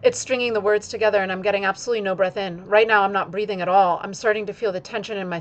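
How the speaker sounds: words strung together fast without pausing for air; breathless run-on demo, pressing forward; rushed, no breath. Shown as a demonstration. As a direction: rush on, never stopping to breathe. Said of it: "is speaking without taking a breath".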